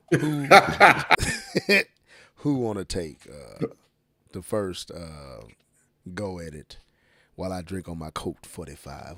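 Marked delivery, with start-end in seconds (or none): 2.4-9.2 lower, soft sick croak; low hoarse voice. Shown as a demonstration. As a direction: keep the sick raspy tone. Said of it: "Let me match his tone".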